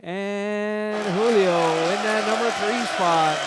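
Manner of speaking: loud, high results call; excited. Real, not acted. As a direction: announce the finish proudly. Announcing finishing order.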